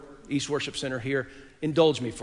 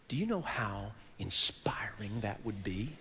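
breathless whisper, slow and much softer; awed whisper; 5 semitones lower, 10 dB quieter than usual. Real, not acted. Preacher to congregation, whispering in awe. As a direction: lean in and whisper it in wonder.

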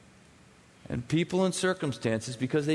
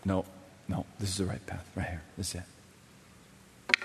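much softer and lower, slowing into a whisper; God's gentle voice; 11 semitones lower, 8 dB quieter than usual. Acted. God tells the listener the right path, softly whispered. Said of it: "God says".

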